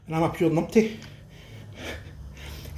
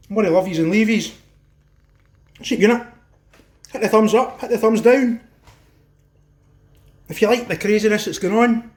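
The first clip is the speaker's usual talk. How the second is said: loud, high energetic outro; hyped sign-off; 4 semitones higher, 4 dB louder than usual. Acted. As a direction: shout the sign-off to the fans.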